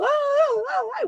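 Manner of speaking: sobbing cry done for the congregation; imitated wailing sobs. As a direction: let out a high crying wail.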